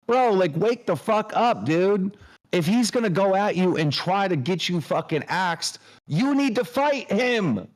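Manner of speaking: rising heated pep talk building to a peak; worked-up coach yelling at a friend to fight back; fired-up rant. Real, not acted.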